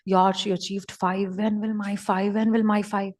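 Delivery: wistful inner voice. Retold or imitated. Retelling own wistful thoughts.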